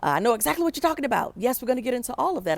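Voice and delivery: mock an enthusiastic professor's eager reply; rousing yes. Professor eagerly agreeing with the student, rousing and fast. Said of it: "I was expecting a rousing".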